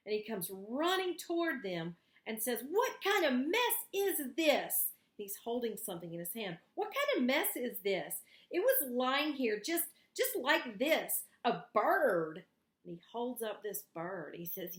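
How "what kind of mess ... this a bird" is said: put-on a fussy boy scandalised by a mess; outraged Valentine. Valentine loudly scolds the mess he finds in the woods.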